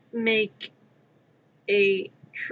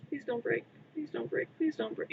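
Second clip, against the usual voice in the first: soft, higher whispered begging; pleading whisper; 5 semitones higher, 8 dB quieter than usual. Real, not acted. Begging the fragile piece not to break, whispered. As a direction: whisper the plea over and over.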